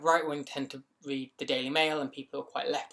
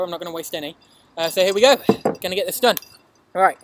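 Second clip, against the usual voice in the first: excited; 4 semitones higher, 11 dB louder than usual. Real, not acted. Psyching up for the ice bucket.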